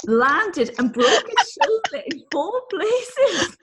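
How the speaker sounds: high, squeaky words through laughter; slow down as the giggles take over; real cracking up on the punchline; helpless laughter. Real, not acted.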